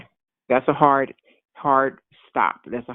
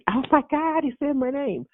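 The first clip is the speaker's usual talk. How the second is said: jumping-out-in-the-street shout, much higher; excited scream; 12 semitones higher than usual. Retold or imitated. Preacher retelling his own joyful street scream, pitched way up. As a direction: yell it like you can't contain the joy. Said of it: "like that".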